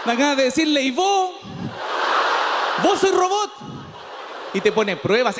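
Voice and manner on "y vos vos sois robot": mock comeback. Comedian retorts at website.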